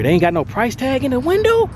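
high, incredulous question; surprised question. Real, not acted. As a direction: ask it like you can't believe it. Surprised no price tag.

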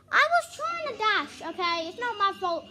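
high, loud whiny protest; defensive outburst. Real, not acted. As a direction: protest that it wasn't your fault. Defensive outburst after dying.

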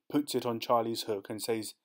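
ordinary delivery, nothing marked

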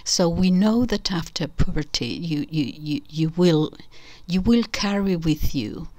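ordinary delivery

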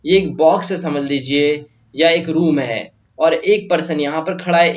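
normal talking voice

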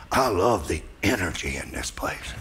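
breathy hushed quote of a visitor; hushed whisper. Retold or imitated. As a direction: whisper it like a newcomer in awe. Newcomers' words quoted.

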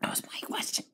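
whispered aside. Real, not acted. Whispering an aside to viewers. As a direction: breathe it as an aside.

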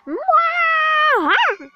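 exaggerated mocking mwah; mock kiss sound. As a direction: imitate the boss's taunting kiss. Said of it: "why does he go".

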